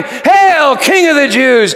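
loud, high jeering shout; mocking soldiers' cry. Soldiers mocking Jesus as king, jeering shout. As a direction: bellow the mock salute to the beaten king.

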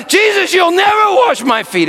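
high shouted protest; Peter crying out. Acted. Peter refusing Jesus, shouted high protest. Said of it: "he cries out".

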